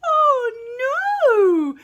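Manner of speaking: high, loud cry of alarm; scared high wail; frightened cry. Acted. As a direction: yelp it out as he flees. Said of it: "said Big James".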